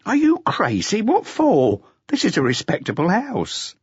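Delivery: mock-shocked refusal; indignant waitress. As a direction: push him off, half outraged.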